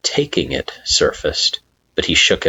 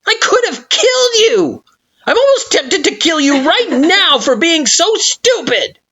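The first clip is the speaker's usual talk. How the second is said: furious, near-tearful yell; 4 dB louder than usual. Acted. Flowey still furious.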